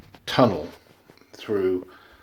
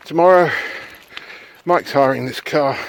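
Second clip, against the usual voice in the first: usual speaking voice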